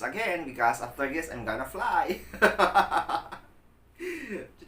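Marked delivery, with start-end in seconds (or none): 1.3-4.6 laughing through a joke. Real, not acted. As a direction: giggle out the punchline. Joking about eating wings.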